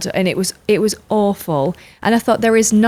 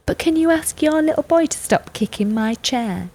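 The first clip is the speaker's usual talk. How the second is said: much higher, softer, prissy request; prim passenger; 8 semitones higher, 3 dB quieter than usual. Retold or imitated. Woman on a plane asking about the kicking, higher and prim. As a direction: put on a prim, polite complainer. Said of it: "She was like".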